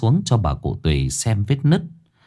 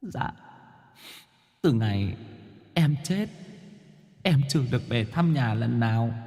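pleading ghost; 2 semitones higher, 9 dB quieter than usual. Acted. Vong Quân kể.

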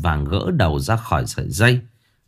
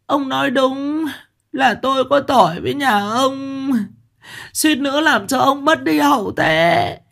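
wailing lament; 13 semitones higher than usual. A narrator's reading. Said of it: "khóc bù lu bù loa".

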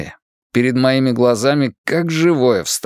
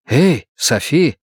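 louder call; 3 semitones higher, slower than usual. Acted. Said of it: "сказал громче".

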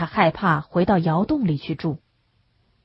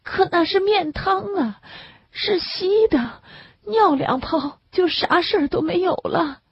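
tearful sick man; 12 semitones higher than usual. A narrator's reading. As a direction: plead it through a tight, weepy throat. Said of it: "嗓子带着哭腔". (带着哭腔的病人，声音高而慢)

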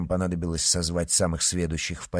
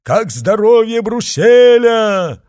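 high, drawn-out yell; shouting crowd; 13 dB louder, slower than usual. A narrator's reading. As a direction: shout the question like a crowd demanding news. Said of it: "кричала толпа". (протяжный громкий крик толпы)

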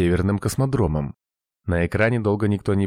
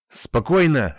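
sharp, higher-pitched bark; barked command; 7 semitones higher, slower than usual. A narrator's reading. Rich checks the panicking operator, barked. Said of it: "рявкнул Рич".

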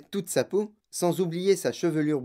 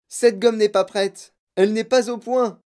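loud, raised, insistent warning; urgent protest; 5 semitones higher, 7 dB louder than usual. Acted. Candy maker warning girl off gum, urgent protest.

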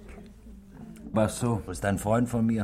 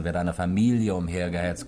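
ordinary talk

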